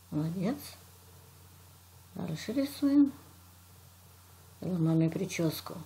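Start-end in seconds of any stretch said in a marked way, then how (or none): none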